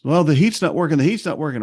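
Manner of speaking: mock frantic renter on the phone; panicky college kid. College kid calling about the heat, panicky.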